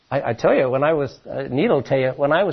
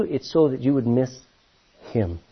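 ordinary delivery

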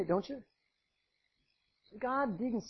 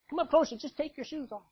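gentle invitation; 7 semitones higher than usual. Acted. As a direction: draw him near kindly. Preacher voices a welcoming God.

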